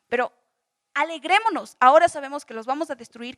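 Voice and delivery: loud, forceful rallying cry; arenga triunfal. Acted. Acted rallying of weeping people. (arenga fuerte, animando al pueblo)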